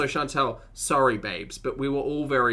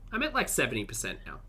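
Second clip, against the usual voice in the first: ordinary delivery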